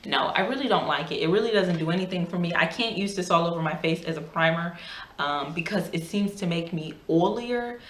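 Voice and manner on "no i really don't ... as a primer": ordinary talk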